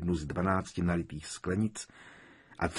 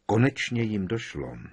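ordinary delivery, nothing marked

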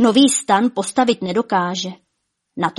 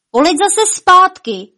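high, loud, drawn-out call; 10 semitones higher, 7 dB louder, slower than usual. A narrator's reading. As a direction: call her back from afar. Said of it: "volal Lumír".

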